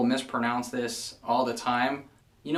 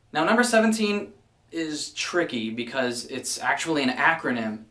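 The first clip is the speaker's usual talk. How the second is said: ordinary delivery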